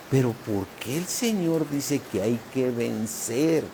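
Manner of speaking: ordinary delivery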